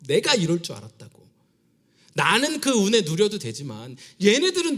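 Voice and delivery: high, loud, driving sermon climax; impassioned preaching. Acted. Pastor preaching to the church, loud and fervent. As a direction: preach it out full force to the congregation. Preaching at full force about Jonah.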